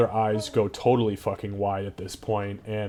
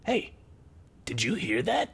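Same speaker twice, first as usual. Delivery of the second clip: lightly voiced wary goblin, softly rising; a hint of a goblin asking its mate; 8 semitones higher, 5 dB quieter than usual. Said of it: "there's one voice that says".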